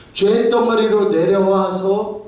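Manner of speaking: 죄 drawn out high and loud, rising, slowly; slow emphatic preaching. Acted. Preacher to congregation, 죄 slowed and stressed emphatically. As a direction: spell out 죄 덩어리로 slowly and weightily.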